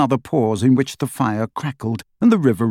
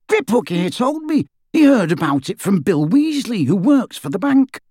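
higher, brisk fugitive wizard; rough wizard voice; 6 semitones higher than usual. A narrator's reading. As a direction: voice him as a cocky escaped wizard. Dirk, higher character voice.

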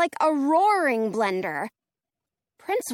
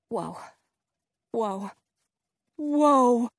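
awed whoa; much slower than usual. A narrator's reading. Seeing the tornado.

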